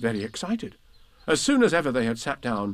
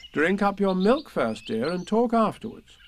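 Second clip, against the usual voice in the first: motherly; 8 semitones higher than usual. A narrator's reading. Kanga mothering Roo.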